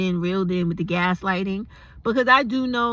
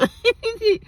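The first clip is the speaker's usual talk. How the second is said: high giggle; 3 dB louder than usual. Real, not acted. Laughing after gushing.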